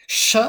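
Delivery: a clear "sh" as in show; Georgian sh. Shown as a demonstration. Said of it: "English sh from show".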